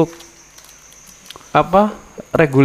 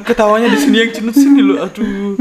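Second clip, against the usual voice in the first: laughing while it hurts; pained laugh, wincing; laughing through pain; 6 semitones higher than usual. Real, not acted. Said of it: "Jam segini ketawa tuh sakit banget loh". (tertawa sambil kesakitan)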